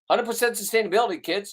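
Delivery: high, fast catchphrase; mock-proud slogan; catchphrase shout.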